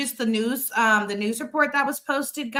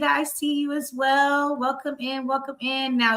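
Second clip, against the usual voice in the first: ordinary talk, nothing marked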